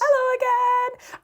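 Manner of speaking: bright high-pitched slow greeting; high sing-song hello. Real, not acted. Cheery high greeting to listeners.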